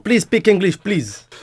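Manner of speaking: high, loud mock Indian accent. Shown as a demonstration. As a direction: plead in put-on Indian English. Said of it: "avec un accent indien". (accent indien forcé, en anglais)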